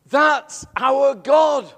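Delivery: very slow and loud, every word weighted; slow proclamation. Real, not acted. Preacher to the congregation, slow and loud in awe. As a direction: declare it with awe. Preacher's climax after story.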